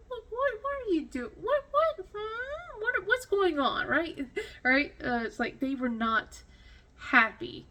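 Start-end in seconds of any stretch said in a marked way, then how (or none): 0.1-3.9 much higher squeaky puzzled cat; be the baffled cat meeting her owner; confused cat voice. Pretend. The cats greeting their owner home late, high confused squeak. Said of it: "the cats were like".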